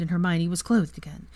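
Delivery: plain reading voice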